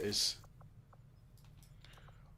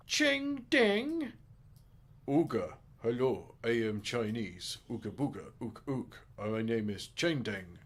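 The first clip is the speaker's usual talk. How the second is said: mock caricature voice; 4 dB louder than usual. Naming a new recruit, a loud hammed-up mock voice. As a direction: ham up a cartoonish mock persona. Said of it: "I hope he's got an Australian accent".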